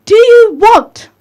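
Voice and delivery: high, loud, slow shout; retold shout. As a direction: yell it at her to wake her up. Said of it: "She shouted at her".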